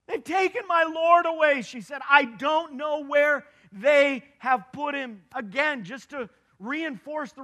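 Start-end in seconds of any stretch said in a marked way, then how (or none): 0.1-1.6 higher and louder, a desperate cry; Mary's anguished protest, raised; grieving plea. Acted. Mary grieving to the angels. 2.1-5.2 slow and louder, bewildered grief; Mary lost and pleading, slowed; anguished plea. Acted. Mary grieving to the angels.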